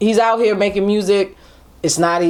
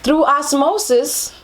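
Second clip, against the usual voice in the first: mock wise; 8 semitones higher, much slower than usual. Mock enlightened quote.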